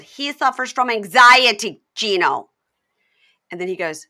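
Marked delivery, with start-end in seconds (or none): 0.0-2.5 imitating Jasmine. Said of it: "no, she said".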